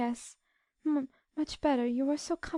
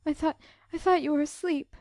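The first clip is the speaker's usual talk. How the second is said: high, halting, tearful voice; sobbing; 8 semitones higher than usual. A narrator's reading. Beth to her sister at night, sobbing. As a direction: stammer it through stifled sobs. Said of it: "sobbed Beth".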